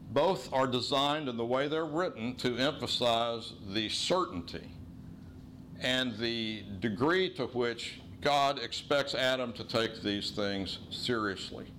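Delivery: normal talking voice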